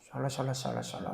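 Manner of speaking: quick low babble of nonsense syllables; mock tongues babble. Teacher mimics tongues for students, low babble. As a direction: babble 샬라샬라샬라샬라 like speaking in tongues. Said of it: "이상한 말 해".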